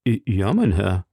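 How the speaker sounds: trembling answer. A narrator's reading. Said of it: "antwortete der Krämer mit Zittern".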